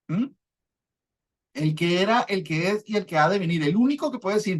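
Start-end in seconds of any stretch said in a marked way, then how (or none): none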